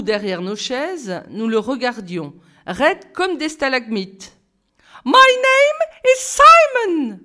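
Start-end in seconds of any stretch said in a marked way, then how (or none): 5.0-7.2 put-on very high, loud, slow, in an English accent; exclaiming English teacher. A narrator's reading. English teacher Simon introducing himself to the class, very high and loud. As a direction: announce yourself like a grinning showman. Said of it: "s'exclama-t-il".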